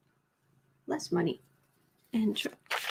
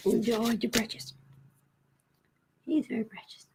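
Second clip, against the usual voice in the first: softer, hushed praise for the cat; whispered cooing; 6 dB quieter than usual. Real, not acted. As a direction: breathe it fondly to the pet.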